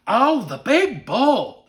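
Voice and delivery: put-on high, loud, drawn-out child voice; awestruck little boy. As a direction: imitate a kid marveling, stretching each word. Retold child names favorite moose.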